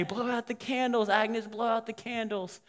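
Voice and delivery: high, eager party chant; imitating excited guests; acted urging. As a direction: egg on the birthday girl.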